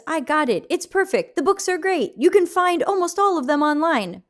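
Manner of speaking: high, lightly voiced chat reader; reading a viewer's message. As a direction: lift the voice to read the comment.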